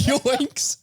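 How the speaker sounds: ordinary talk, nothing marked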